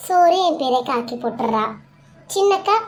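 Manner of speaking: usual speaking voice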